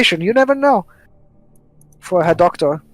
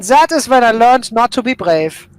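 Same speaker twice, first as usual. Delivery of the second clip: louder, higher in-character line; raised, emphatic role-play voice; character looking back; 4 semitones higher, 5 dB louder than usual. Acted. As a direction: play the character delivering a hard-learned lesson. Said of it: "later on he will say".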